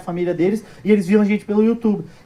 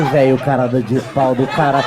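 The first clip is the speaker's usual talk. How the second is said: slow amazed disbelief; 6 semitones lower, slower than usual. Retold or imitated. His reaction to the old man.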